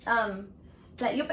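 normal talking voice

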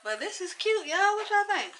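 high, delighted gushing; excited. Real, not acted. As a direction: gush over how cute it looks. Excited about the wig on.

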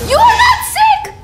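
high, loud mock shouting dad; imitating father's shout. Kid mimicking father's verdict, high loud shout. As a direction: yell it like an exasperated father. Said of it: "why he's being crazy and saying I'm not sick".

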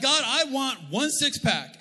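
high, loud, slow plea to God; demanding prayer. Acted. Preacher acts out praying for a six-pack, demanding. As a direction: pray it like an insistent demand.

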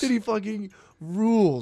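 drawn-out high insistence; high, slow, amused. Real, not acted. Comic insisting to his friend the guy rules.

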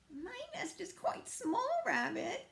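very high-pitched, small bird character; little bird voice. Acted. Bird warns Rabbit about the small nest, tiny high voice. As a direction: chirp it up high, politely doubtful.

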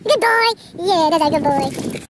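high sing-song dog praise; squeaky praising a dog. Real, not acted.

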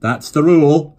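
loud hearty fatherly pronouncement; a jovial father declaring the rule; firm father. Acted. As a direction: lay down the rule.

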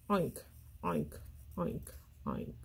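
soft, fading grunted "oink"; whispered pig grunts. Shown as a demonstration. Teacher voicing the pig for children, grunts fading to a whisper.

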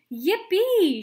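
high, loud whoop of glee; shouted yippee; joyful shout. A narrator's reading. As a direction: whoop it out like a kid. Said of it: "shouted Grandpa Joe".

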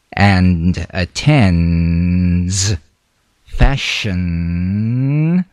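slow with pauses between phrases; slow phrased. Shown as a demonstration. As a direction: separate each phrase carefully. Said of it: "Feel the z in these sentences".

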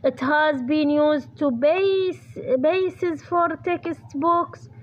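very slow, word by word, for writing down; slow dictation. Shown as a demonstration. Teacher dictating the answer to her students, slow and deliberate. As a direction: spell it out slowly so students can copy.

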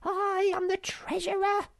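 very high monkey voice, full of importance; pompous little creature; self-important. Acted. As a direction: announce the title grandly in a squeaky high voice. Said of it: "she said importantly".

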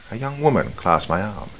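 ordinary delivery, nothing marked

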